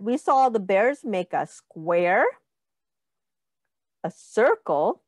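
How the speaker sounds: usual speaking voice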